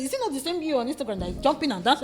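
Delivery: loud, high, fast mock challenge; confrontational woman. A stranger confronts her about Instagram, loud fast mock challenge. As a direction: fire questions like an offended fan.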